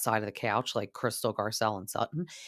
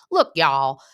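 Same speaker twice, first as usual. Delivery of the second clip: loud mock Southern accent; Sutton; 9 dB louder than usual. Imitating Sutton put on the spot, deepened Southern accent. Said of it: "she's gonna deepen her accent".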